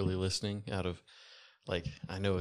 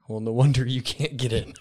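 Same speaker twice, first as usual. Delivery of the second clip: smug taunt; 4 semitones higher, 9 dB louder than usual. Acted. Griff mocks the doorman.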